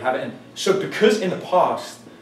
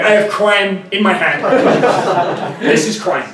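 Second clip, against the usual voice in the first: louder, higher, slow mock threat; slow, mocking tough-guy line; mock mugger voice; 5 semitones higher, 7 dB louder, slower than usual.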